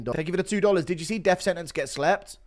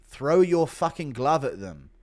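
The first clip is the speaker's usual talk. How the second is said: ordinary talk, nothing marked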